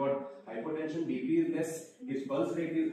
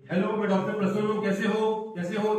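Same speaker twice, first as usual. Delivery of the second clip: louder, higher, quick greeting; calling to a patient; 5 semitones higher, 6 dB louder, much faster than usual. Pretend. Doctor checking if a fallen patient responds, calling out.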